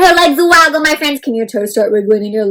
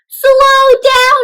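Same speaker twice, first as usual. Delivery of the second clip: high, loud sing-song call; Dada calling; 11 semitones higher, 6 dB louder than usual. Acted. Dada calling Mina to slow, loud sing-song. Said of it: "Dada says".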